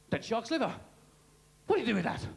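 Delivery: ordinary talk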